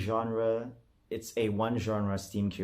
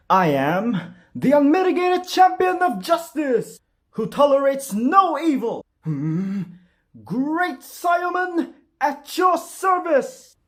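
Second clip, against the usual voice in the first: very high, loud heroic proclamation; over-the-top superhero announcing himself; Great Saiyaman; 10 dB louder, slower than usual. Acted. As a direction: boom it out like a masked anime hero. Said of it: "Super Saiyaman".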